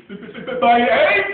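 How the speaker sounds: stuttered b- sounds bursting into a loud cry; stammering shout. Acted. Horton startled by his hatching egg, stammering shout. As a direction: splutter then shout it out. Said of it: "shouted Horton".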